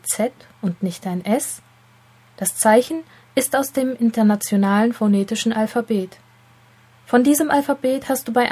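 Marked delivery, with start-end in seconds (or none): none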